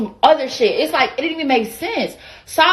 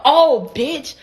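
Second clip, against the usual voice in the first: lively, pitched-up "Oh, bitch"; confrontational "bitch"; 3 semitones higher than usual. Shown as a demonstration. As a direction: say it as a real insult, with punch. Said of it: "But it wasn't like a".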